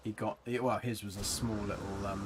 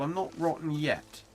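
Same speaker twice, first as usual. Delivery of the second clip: ordinary delivery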